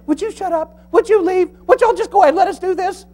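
high, fast exasperated women's voice; mocking the women. Preacher imitating wives shushing husbands, high mock whine. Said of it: "And the women are saying".

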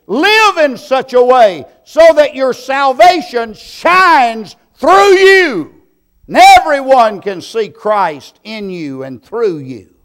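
soaring, high and loud pulpit cry; preaching at full force. Acted. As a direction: lift the congregation with a ringing call.

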